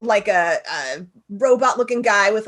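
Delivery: ordinary delivery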